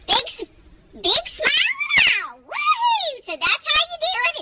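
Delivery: high, exuberant sign-off; playful cheer. Pretend. Host back after laughing fit, high playful cheer. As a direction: whoop it up for the viewers.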